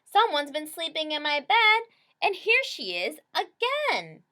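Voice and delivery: high, loud scared cub voice; baby bear finding Goldie in bed; trembling baby bear. A narrator's reading. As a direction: quaver like a cub in a nightmare.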